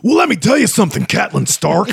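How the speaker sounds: high, fast wrestler promo voice; hammed-up wrestler taunt; Macho Man Randy Savage. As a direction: raise the pitch and rattle it off like a wrestler's challenge. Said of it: "Read it like Macho Man Randy Savage".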